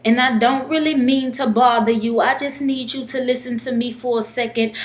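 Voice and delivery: earnest, rhythmic poetry recital in a homeless man's persona; spoken-word poem. Acted. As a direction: perform it as a heartfelt plea in verse. Performs his spoken-word poem.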